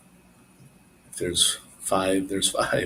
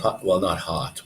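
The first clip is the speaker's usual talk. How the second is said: normal talking voice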